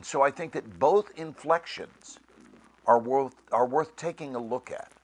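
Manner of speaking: ordinary talk, nothing marked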